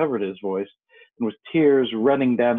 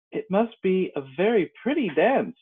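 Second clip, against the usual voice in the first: higher-pitched, a touch timid; mildly timid; 7 semitones higher than usual. A narrator's reading. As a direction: say it high, as a slightly shy Alice. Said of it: "said Alice timidly".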